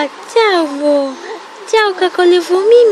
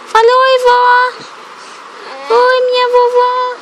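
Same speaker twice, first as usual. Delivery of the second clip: put-on high, sing-song baby talk; baby talk to grandma; 8 semitones higher than usual. Mom greets grandma for baby. (voz fininha de bebê falando com a vovó)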